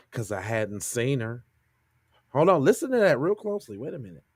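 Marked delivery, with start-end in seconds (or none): 0.1-1.4 low, soft, slow quote of a creepy man; creepy neighbor imitation. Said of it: "That was the creepiest voice".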